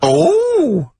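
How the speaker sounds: high, hammed-up comedy character voice; Dirty Old Queer. Host introducing a comedy character on air, high hammy voice. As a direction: squeak it out as a silly new character. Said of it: "it's Dirty Old Queer".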